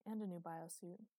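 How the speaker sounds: plain reading voice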